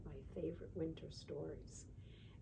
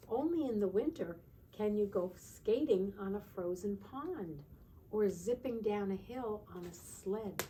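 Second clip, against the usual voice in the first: put-on reading to children; 2 semitones higher, 6 dB louder than usual. Teacher reading a winter book to the class, storybook voice. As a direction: read it like a bedtime picture book.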